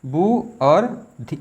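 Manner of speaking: slow, spelled-out syllables; dictating each syllable slowly. Shown as a demonstration. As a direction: break the word into slow pieces. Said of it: "सुबुद्धि लिखा जाएगा सु".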